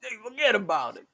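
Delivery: classic New York "forget about it"; New York accent. Shown as a demonstration. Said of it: "How many kinds of New York accent".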